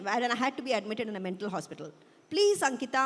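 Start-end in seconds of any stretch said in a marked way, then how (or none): 2.3-3.0 higher, louder plea; pleading whine. A narrator's reading. Father begging his daughter to take medication, pleading. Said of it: "my father pleads. I detest the whine in his voice".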